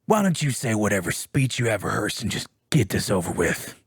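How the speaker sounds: low, defiant tough-guy impression; defiant Joel. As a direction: play a captive who refuses to beg, voice dropped. Acts Joel's defiant last speech.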